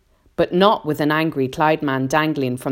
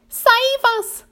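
very high, loud plea; crying out; 5 dB louder than usual. A narrator's reading. Miss Spider pleads as the peach plummets, panicked cry. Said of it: "cried Miss Spider".

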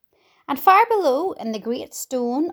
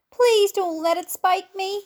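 high-pitched anxious plea; begging; 8 semitones higher than usual. A narrator's reading. Earthworm begging James to save him from the seagull, pleading. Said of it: "begged the earthworm".

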